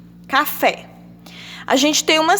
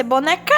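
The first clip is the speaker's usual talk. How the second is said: boneca said as bone-CÁ, rising on the end; wrong stress on final CA. Shown as a demonstration. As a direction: throw the stress onto the last syllable of boneca. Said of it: "Se fosse o K, ia ser". (boneca com o "ca" final forte)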